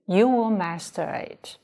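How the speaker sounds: normal talking voice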